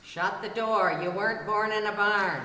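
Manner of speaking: ordinary talk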